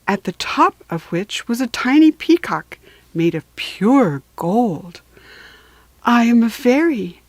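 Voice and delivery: lively storybook narration; animated picture-book reading; reading to children. A narrator's reading. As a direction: read it like a bedtime picture book. Reads story aloud to children.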